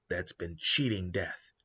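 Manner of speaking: normal talking voice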